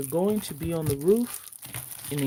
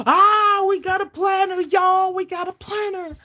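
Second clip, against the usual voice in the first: much higher, louder squeal of joy; excited yelling; 8 dB louder than usual. Real, not acted. Host yells to viewers about the new planner, thrilled. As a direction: shout it out, thrilled. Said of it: "I apologize for yelling in y'all's ears".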